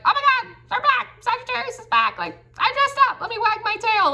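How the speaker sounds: very high, breathless puppy glee; overjoyed dog voice. Pretend. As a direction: squeal like a dog whose owner just came home. Dog greeting owner coming home.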